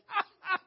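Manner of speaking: loud, high whooping laughter; hearty laugh. Real, not acted. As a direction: burst into a big high laugh. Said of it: "laughed at it".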